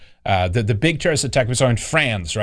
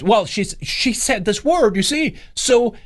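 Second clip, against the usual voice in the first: higher, slow excuse-making voice; mock imitation; 10 semitones higher, slower than usual. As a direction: whine the excuse in a raised pitch. Imitating man in the video.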